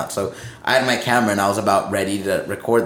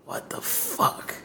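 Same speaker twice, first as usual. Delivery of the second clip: retold drawn-out whisper of disbelief; stunned whisper; 9 dB quieter, much slower than usual. Stunned at the crew's devotion, slow whisper. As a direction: whisper it slowly, dumbfounded.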